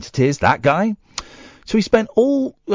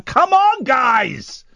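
exasperated call; 10 semitones higher, much slower than usual. Retold or imitated. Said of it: "I'm going".

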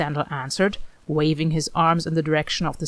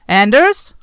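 loud call, pitched up; searching shout through the house; 4 semitones higher, 9 dB louder than usual. A narrator's reading. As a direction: call his name from room to room. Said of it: "Hawk called".

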